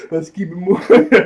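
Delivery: high, loud, through laughter; laughing. Real, not acted. Reviewer amused at Aang walking away, laughing. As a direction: crack up while saying it. Cracking up at his own retelling.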